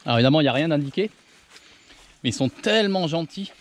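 ordinary talk

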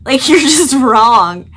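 giggling through the words; laughing. Real, not acted. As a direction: laugh it out playfully. Repeats it laughing.

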